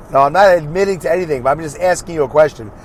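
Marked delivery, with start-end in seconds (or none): none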